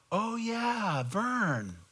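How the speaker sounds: high, soft dawning realization; retold realization. Preacher recalls remembering Vern, retold realization.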